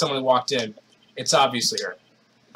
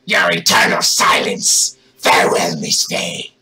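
villain shout; 10 semitones lower, 5 dB louder than usual. Acted. Villain's final threat.

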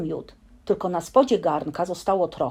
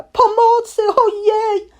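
stuck bear crying out; 8 dB louder, slower than usual. Acted. Pooh stuck in honey pot.

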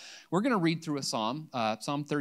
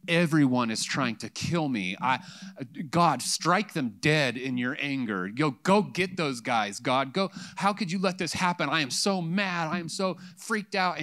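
angry psalmist; 3 semitones higher, 4 dB louder than usual. Retold or imitated. Preacher voicing David raging at God: loud angry plea. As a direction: storm at God like an outraged David.

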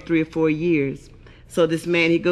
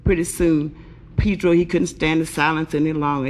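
usual speaking voice

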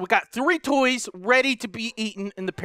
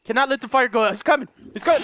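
ordinary delivery

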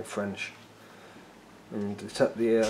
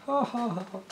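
sarcastic fake laugh; 13 semitones higher than usual. Real, not acted.

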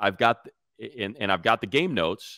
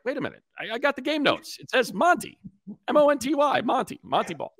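retold confused self. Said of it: "and I was like".

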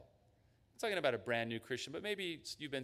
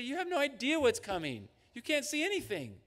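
much higher, louder rebuke; firm rebuke; 11 semitones higher, 4 dB louder than usual. Acted. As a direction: correct him sharply, lovingly. God rebukes the listener.

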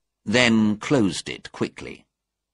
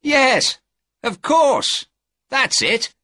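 high, louder exclamations of realisation; excited cry; 5 dB louder, slower than usual. A narrator's reading. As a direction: burst out as the answer dawns. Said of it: "he cried".

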